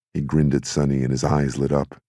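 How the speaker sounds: plain reading voice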